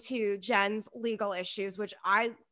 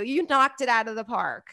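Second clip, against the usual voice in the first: ordinary delivery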